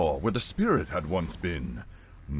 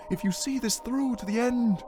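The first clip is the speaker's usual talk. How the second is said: high ghostly reassurance; high-pitched ghost woman, insistent; insisting spirit. A narrator's reading. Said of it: "the voice insisted".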